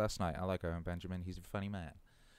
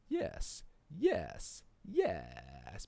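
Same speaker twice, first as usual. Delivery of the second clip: silly voices; 5 semitones lower, much slower than usual. Pretend. Playing with his voice.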